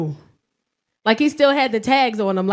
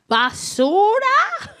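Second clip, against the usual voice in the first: high slow mimicked question; 10 semitones higher, much slower than usual. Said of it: "my son walked by".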